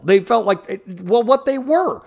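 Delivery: usual speaking voice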